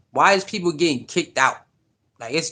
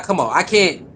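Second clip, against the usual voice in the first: ordinary talk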